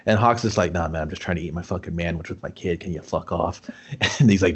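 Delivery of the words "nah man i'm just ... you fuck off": low, quick, softer imitation; low-key brush-off. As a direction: play someone mildly brushing off a pest. Acting out Hawk brushing off challenger.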